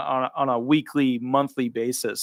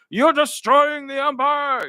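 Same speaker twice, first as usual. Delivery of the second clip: much higher, slow, hammed-up doom voice; mock Republican; 12 semitones higher, slower than usual. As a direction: ham up a dramatic warning of doom. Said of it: "the Republicans are like".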